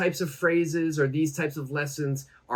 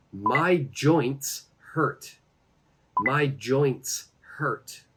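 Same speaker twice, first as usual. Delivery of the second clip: very slow, separated words for learners; slow model phrase; much slower than usual. Shown as a demonstration. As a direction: space out each word as a language teacher.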